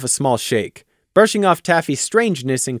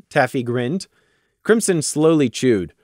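ordinary delivery

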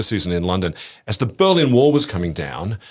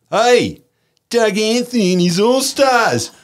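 loud, high, drawn-out yell; heckler's shout; 5 dB louder, slower than usual. Retold or imitated. Heckler hailing the comedians at Checkpoint Charlie, loud mock yell. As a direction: holler across the checkpoint like a heckler.

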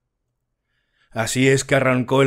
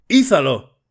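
a mock hoist call, a touch louder; sailors' shout; 4 semitones higher, 5 dB louder than usual. A narrator's reading. Sailors on the ship call out while hauling crates, mock shout. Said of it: "gritaban cuando subían cada cajón".

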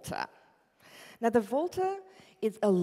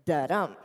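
da DUM, weak then strong; da-dum rhythm; 3 semitones lower, 7 dB louder than usual. Shown as a demonstration. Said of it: "that goes".

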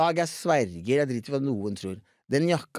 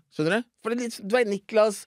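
ordinary delivery

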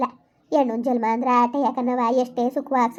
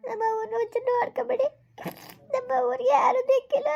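tearful bride's voice; 10 semitones higher than usual. Acted. Bride pleads as she leaves home.

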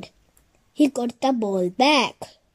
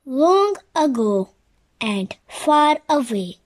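plain reading voice

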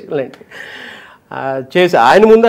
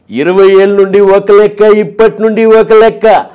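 imitating Prabhas; 5 dB louder than usual. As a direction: deliver the Mirchi punch line like the hero. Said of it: "అది ప్రభాస్ గారిది".

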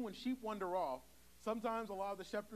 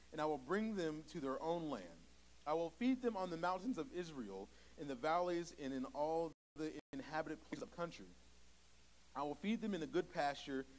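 scripture reading; 3 semitones lower than usual. Acted. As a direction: proclaim the verses to the congregation. Reads scripture aloud.

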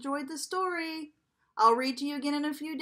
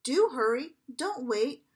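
ordinary delivery

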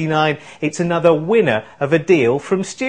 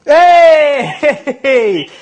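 excited cheer; 9 semitones higher, 7 dB louder than usual. Real, not acted. A player calls house.

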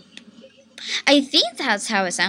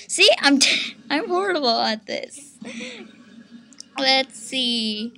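ordinary talk

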